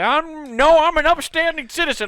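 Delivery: put-on high, loud, prim old-timer protesting; indignant old person. Old person answers a tracksuit joke, high and indignant. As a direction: puff up like a proud old man insisting he's upstanding. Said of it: "old people are like".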